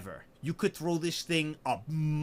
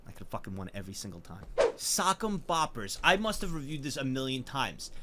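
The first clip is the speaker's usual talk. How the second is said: usual speaking voice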